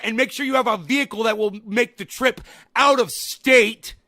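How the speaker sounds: loud, high, slow furious sarcasm; sarcastic yelling tirade. Real, not acted. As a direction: shout the list out mockingly, slowly.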